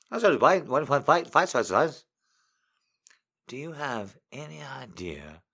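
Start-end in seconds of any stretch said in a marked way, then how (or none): 0.4-2.0 higher, loud mock mumble; mock-garbled, loud and high. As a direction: imitate the confused man, half-shouting. Acting out a confused exchange.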